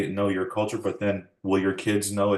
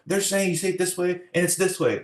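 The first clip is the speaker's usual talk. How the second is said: put-on child complaining; 11 semitones higher than usual.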